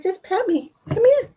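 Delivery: lightly voiced cat, high and pleading; voicing the cat. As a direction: speak for the kitty in a high little voice. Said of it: "She's like".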